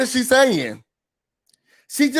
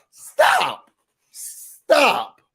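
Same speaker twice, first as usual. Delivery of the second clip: a high squeal of stop, no more; exasperated stop; 5 semitones higher than usual. Real, not acted. Vlogger fed up with the catchphrase, squealed stop. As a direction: beg them to quit it.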